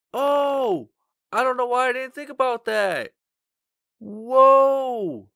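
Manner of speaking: high frantic screaming; panicked yelling. Real, not acted. Running through fire in game.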